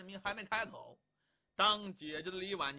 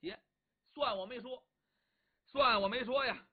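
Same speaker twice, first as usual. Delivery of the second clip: grudging giving in; 5 semitones higher, 5 dB louder than usual. Acted. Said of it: "一嘬牙花".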